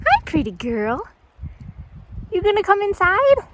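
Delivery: high cooing pet voice; sweet singsong to the horse; talking to a horse. Real, not acted. Greeting horse.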